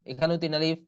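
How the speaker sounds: ordinary talk, nothing marked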